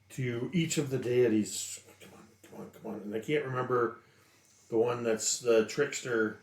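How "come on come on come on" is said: very soft, low urgent mumble; muttering under breath. Acted. Pleading with each of the deities, muttered under breath. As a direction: mutter it quickly to yourself. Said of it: "mutter under my breath".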